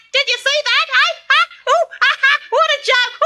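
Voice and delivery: high, loud gloating bird laugh; cackling kookaburra. Acted. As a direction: crow with laughter over the dead snake.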